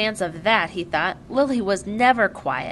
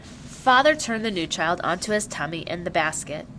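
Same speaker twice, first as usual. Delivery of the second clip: usual speaking voice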